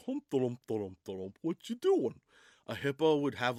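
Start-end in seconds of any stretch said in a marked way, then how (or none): 0.1-2.2 goofy giraffe. Acted. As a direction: hum along like a lumbering cartoon giraffe. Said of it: "a giraffe is always like".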